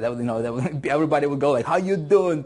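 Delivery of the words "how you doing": put-on audience copying a New Yorker accent; lightly imitated New Yorker accent. The audience greeting each other, imitating a New Yorker accent. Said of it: "they were talking like that".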